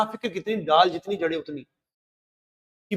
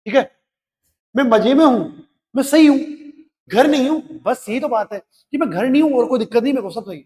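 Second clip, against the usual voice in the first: cheerful reassurance; 5 semitones higher, 6 dB louder than usual. Acted. Son's cheerful message voiced.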